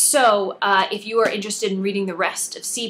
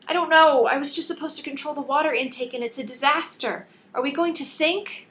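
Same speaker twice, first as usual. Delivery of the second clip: put-on young assistant voice rising in alarm; panicky Callie; 7 semitones higher than usual. A narrator's reading. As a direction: blurt it out, scared the ship will sink. Callie panicking about the flood.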